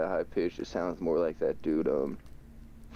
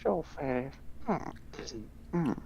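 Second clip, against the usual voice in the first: Herbert voice; 10 semitones higher, 4 dB quieter than usual. Retold or imitated. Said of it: "the Herbert voice from Family Guy".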